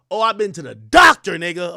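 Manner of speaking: imitating her loud retort; mock shout. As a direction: fire it back at him. Quoting her shouting back.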